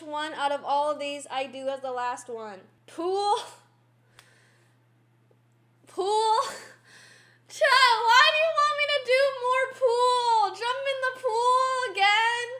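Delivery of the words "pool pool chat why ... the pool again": whining, half-crying protest; whiny. Real, not acted. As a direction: plead like you're dreading it. Dreading another cold pool jump.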